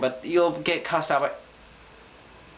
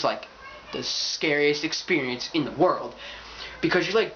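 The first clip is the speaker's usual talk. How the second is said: ordinary talk, nothing marked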